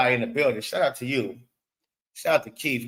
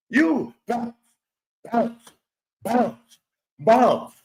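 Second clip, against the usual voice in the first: mocking the extra bounces; 4 semitones higher, much slower than usual. Shown as a demonstration. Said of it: "you don't understand".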